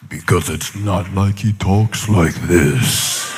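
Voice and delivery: very low, drawn-out booming God voice; slow right down, deep and otherworldly; mock God voice. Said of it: "I don't even know what that was".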